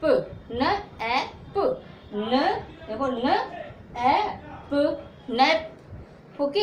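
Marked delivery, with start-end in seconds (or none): 0.0-1.6 न and ऐप split apart and stretched very slowly; sounded-out word. Shown as a demonstration. Teacher to students, slowly sounding out न ऐप. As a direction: break नैप into न ऐप, each part drawn out. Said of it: "तो नैप".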